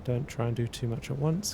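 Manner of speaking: ordinary delivery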